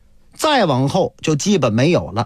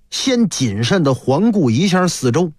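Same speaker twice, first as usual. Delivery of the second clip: ordinary delivery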